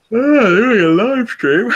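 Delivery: yawning through the words; said mid-yawn. Real, not acted. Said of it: "Easy with the yawning there".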